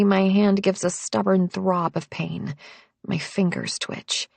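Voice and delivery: usual speaking voice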